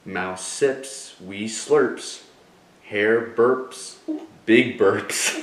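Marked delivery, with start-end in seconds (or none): none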